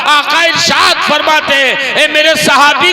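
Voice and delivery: high, loud, half-chanted declamation; full-force preaching. Acted. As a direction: recite the story fervently, drawing out the cries. (बुलंद, लंबी खिंची जोशीली तकरीर)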